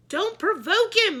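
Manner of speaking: high loud slow warning yell. A narrator's reading. Miss Sookie warning the kids, a loud slow yell. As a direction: warn them loudly. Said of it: "Miss Sookie yelled".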